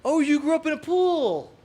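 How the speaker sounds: high, slow put-on Iowan accent; a hammed-up Midwest Iowa voice; playful bad Iowan accent. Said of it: "best Iowan accent I can do".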